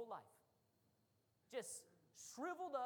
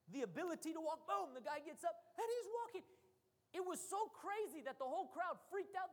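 ordinary talk, nothing marked

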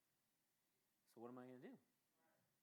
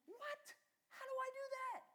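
high, loud, whining disbelief; hammed-up cry of confusion; mock helpless wail; bewildered protest; 6 dB louder, slower than usual. Arguing back with God.

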